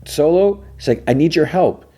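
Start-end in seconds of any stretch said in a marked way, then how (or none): 0.1-0.6 high, rising call of a name; imitated searching call. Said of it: "She's calling Solo". 1.1-1.8 high-pitched appeal through a door; imitated urgent call. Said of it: "She's calling Solo".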